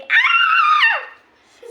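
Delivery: very high, loud shriek; piercing hammed-up scream. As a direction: shriek in sudden fright. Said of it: "the wise old elf is screaming".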